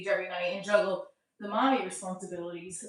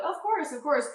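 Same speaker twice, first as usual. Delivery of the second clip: soothing mom; 8 semitones higher than usual. Retold or imitated. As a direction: coo it to a child who wants a hug. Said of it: "I'm like".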